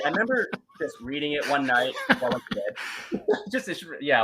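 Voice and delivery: talking through laughter; amused laugh breaking into the words. Real, not acted. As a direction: crack up while telling it. Amused at his excuse for reading.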